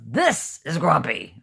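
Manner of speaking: loud, slow, emphatic grump; demonstrated grumpiness. Shown as a demonstration. Showing Jen what real grumpy is, loud mock grumpiness. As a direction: drag it out loudly like the master of grumpy. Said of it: "I'll show you what grumpy is".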